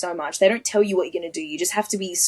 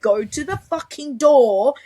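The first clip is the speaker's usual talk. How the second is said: raised, louder, slow command; retold exasperated order; 6 semitones higher, 5 dB louder, slower than usual. Instructor sends her to the door, exasperated shout. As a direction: order it slowly at higher pitch, imitating an instructor.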